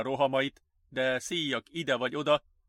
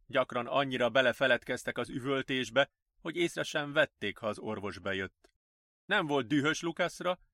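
ordinary delivery

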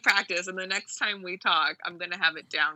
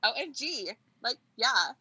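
put-on high-pitched Valley Girl gush; hammed-up California Valley Girl, pitched high; 4 semitones higher than usual. Shown as a demonstration. Guest keeps up her accent for the host, Valley Girl. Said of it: "California Valley Girl".

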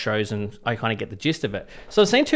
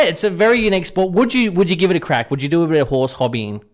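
ordinary delivery

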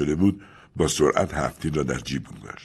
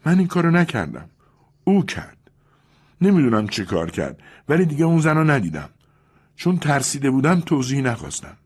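much higher, urgent denial; frightened Julia; 9 semitones higher, 4 dB louder than usual. Acted. Julia to Louis, frightened and insistent. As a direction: insist it wasn't her. Julia blames her partner.

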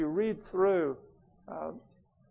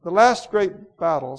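louder, scornful royal tirade; imperious queen Jadis, bitter; 3 semitones higher, 7 dB louder than usual. Acted. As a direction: rage coldly against the sister. Queen's lines.

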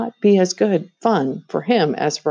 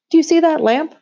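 high, loud point toward the lamp; the queen raises her voice to show the way; calling out; 12 semitones higher, 5 dB louder, faster than usual. A narrator's reading.

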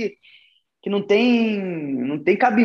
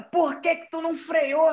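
scolding mother; 8 semitones higher than usual. Retold or imitated.

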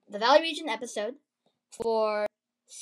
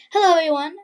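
higher, much louder hello to viewers; loud fresh greeting; 5 semitones higher, 10 dB louder, faster than usual. Real, not acted. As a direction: restart with a big, projected greeting. Restarts greeting in usual accent.

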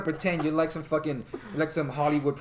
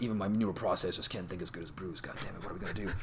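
low, soft, defeated; Vic Stone; 8 semitones lower, 10 dB quieter, faster than usual. Retold or imitated. Vic Stone at Bruce's grave, low and defeated. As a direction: voice a downcast hero, sinking quieter as he goes.

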